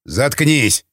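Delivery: ordinary delivery, nothing marked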